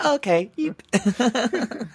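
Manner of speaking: high, squeaky flustered okay; flustered squeak. Retold or imitated. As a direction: squeak it out, embarrassed. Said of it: "I just went".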